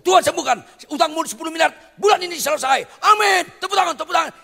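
high, loud hammed-up prosperity preacher; mock hype preaching. Preacher mocking the prosperity sermon crowd, high loud hammed-up shout. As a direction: shout it like a showy healing preacher.

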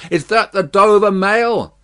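high, loud hailing question; calling from afar. A narrator's reading. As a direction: call through the mist. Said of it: "a man's voice called from the mist".

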